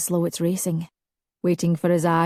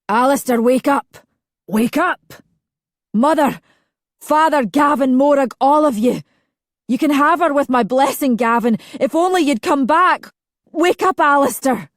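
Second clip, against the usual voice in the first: urgent cry; 7 semitones higher, 6 dB louder than usual. A narrator's reading. Calling through nightmare.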